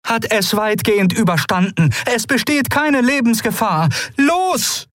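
hohe Stimme, am Ende drängend; Keru drängt. Acted. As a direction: erst beruhigend, dann antreibend. Keru treibt zur Eile. (Keru drängt die Freunde zum Aufbruch)

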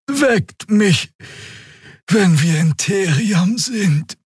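lazily settling in for a nap; drowsy Xia. Acted. Xia lies down.

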